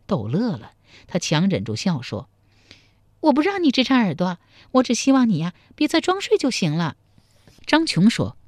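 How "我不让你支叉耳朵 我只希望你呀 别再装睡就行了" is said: put-on high, quick playful young woman; girl's teasing voice. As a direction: tease him in a bright girlish voice. 陶三春 voiced by narrator. (少女俏皮地调侃)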